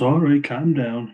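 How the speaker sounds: drawn-out mock-innocent plea; playing the victim. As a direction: imitate him acting hurt and put upon. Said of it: "kinda playing the victim then".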